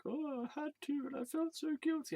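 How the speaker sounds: high, whiny imitation of dad; sending up his father's self-pity; mocking dad. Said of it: "he was like".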